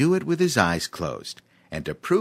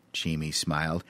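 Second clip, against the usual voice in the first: ordinary delivery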